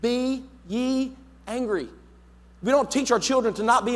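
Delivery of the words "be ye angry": slow, weighty Be ye angry with YE stressed; slow emphatic quote. Real, not acted. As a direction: lean on YE, drawn out. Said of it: "permission to be angry".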